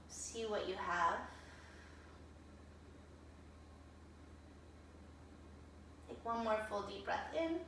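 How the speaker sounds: soft, calm yoga cool-down cues; gentle guided voice. Acted. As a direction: ease them into stillness, quietly.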